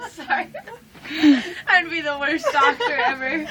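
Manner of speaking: laughing embarrassed apology. Real, not acted. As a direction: laugh through the apology, flustered. Embarrassed during chest exam.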